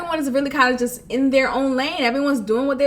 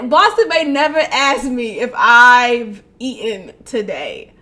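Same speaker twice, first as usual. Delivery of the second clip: ordinary delivery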